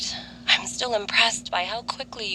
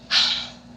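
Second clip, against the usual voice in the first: resigned sigh; 3 dB louder than usual. Acted. Sigh of resignation about fate.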